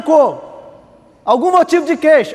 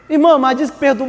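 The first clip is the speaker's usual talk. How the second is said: usual speaking voice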